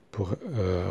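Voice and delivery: normal talking voice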